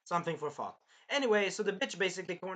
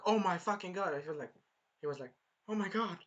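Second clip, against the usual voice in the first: ordinary delivery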